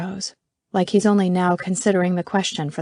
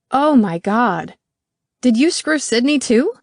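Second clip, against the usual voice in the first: higher, louder, slowed disbelief; shocked; 5 semitones higher, 5 dB louder than usual. Acted. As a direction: gasp it out, then accuse. Shocked accusation.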